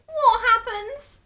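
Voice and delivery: very high, loud and slow; terrified. A narrator's reading. Terrified wife begs to know, very high. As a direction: squeeze it out high in panic.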